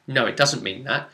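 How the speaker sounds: ordinary talk, nothing marked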